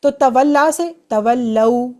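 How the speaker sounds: "تولو" slowly, "لو" with zabar like "لَو"; slow clear drill. Shown as a demonstration. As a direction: stretch "تولا سے تولو" syllable by syllable. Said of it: "آ کی sound آ رہی ہے". ("تولو" آہستہ، لام زبر کے ساتھ "لَو")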